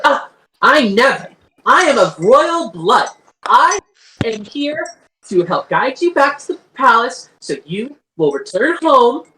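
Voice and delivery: high, swooping regal protest; haughty indignation. Acted. Royal Iri rebuking Nozel for abandoning Mother, haughty indignation. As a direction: play the offended royal, lifting key words high.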